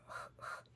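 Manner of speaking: j as a voiceless velar "kh", breath only; isolated J sound. Shown as a demonstration. Teacher isolating the sound, j as voiceless "kh". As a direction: rasp j with no vowel. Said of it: "Tiene que ser ese sonido de j".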